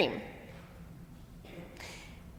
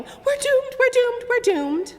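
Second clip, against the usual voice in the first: high panicked wail; panicking frog; 4 dB louder than usual. Acted. Frog one still stuck, high panicked wail. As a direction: keep wailing like a doomed frog. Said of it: "Frog one is still going".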